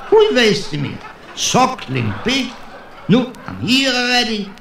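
can't believe his luck, gleeful; excited. Acted. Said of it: "And the waiter says".

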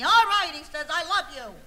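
mock yell of giving in; grudging husband. Husband finally answering his nagging wife, loud and grudging. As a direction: burst out loudly, as if forced to say it.

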